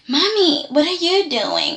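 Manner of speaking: high-pitched imitation of a protesting daughter; imitated daughter, voice lifted high; mock Summer. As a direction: pitch it up like a daughter questioning her mom. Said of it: "And then we saw Summer".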